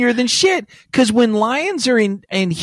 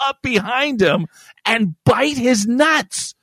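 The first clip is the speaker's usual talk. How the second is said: ordinary talk, nothing marked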